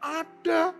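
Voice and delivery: put-on very high kid voice; imitated child, pitched right up; small child. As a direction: pipe up like a child with the answer. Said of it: "Anak kecil".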